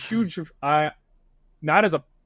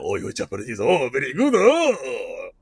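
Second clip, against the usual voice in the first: a lightly voiced old master praising; deep dub voice, mock Japanese; 3 semitones lower than usual. Shown as a demonstration. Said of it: "Japanese English dub voice".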